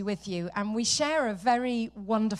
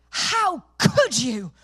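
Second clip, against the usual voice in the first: indignant daughter; 3 semitones higher, 6 dB louder, much slower than usual. Retold or imitated.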